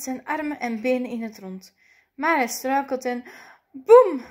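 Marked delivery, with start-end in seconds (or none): 3.7-4.2 big, high, booming thud word; sudden loud storyteller's boom; loud crash word. A narrator's reading. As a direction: burst out with the bang of a fall. Sound of César falling.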